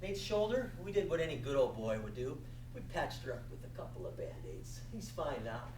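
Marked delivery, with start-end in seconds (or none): none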